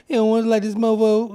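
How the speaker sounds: high, loud imitation of a movie line; cool guy voice. Mocking how a friend did the line, cool guy voice.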